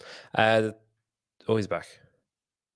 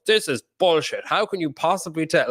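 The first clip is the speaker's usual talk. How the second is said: high-pitched imitation of indignant commenters; mock outrage; 6 semitones higher than usual. Podcast host mimicking angry commenters on his article, mock outrage. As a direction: act out someone pissed off, pitched up. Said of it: "everyone just getting pissed off".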